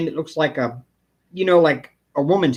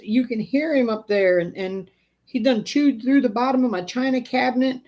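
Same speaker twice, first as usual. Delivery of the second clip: mock tearful old lady; 7 semitones higher than usual. Pest guy retells the tearful old woman's complaint, higher. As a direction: quote the crying customer, pitched up. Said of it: "she's in tears".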